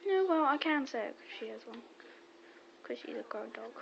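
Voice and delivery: usual speaking voice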